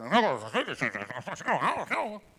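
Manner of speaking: loud garbled gibberish like a broken intercom; mock squawking speaker noise; garbled drive-thru speaker. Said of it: "when it talks like this".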